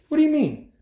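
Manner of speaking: high, loud disbelieving jab; a loud retort to the sergeant; incredulous challenge. Retold or imitated. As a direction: pounce on his words.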